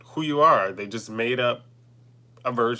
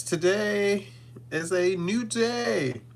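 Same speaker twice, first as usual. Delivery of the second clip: grand announcer voice; 9 semitones higher, slower than usual. Acted. Opening channel greeting.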